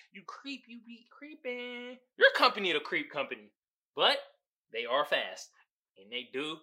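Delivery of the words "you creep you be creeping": mock creepy hush. Teasing Jeff Bezos.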